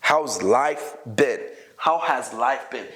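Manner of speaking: slow, measured teacher demonstration; deliberate slowed-down model sentence; slow modelling. Shown as a demonstration. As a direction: say each word slowly for learners to follow. Teacher models contraction, then full form.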